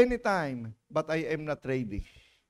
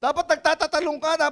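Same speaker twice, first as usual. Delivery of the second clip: ordinary delivery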